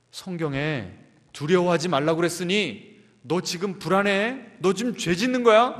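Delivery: loud, pressing accusation; mock browbeating. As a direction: scold yourself harshly, raising the voice. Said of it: "이렇게 막 윽박 지르는 게 아니라".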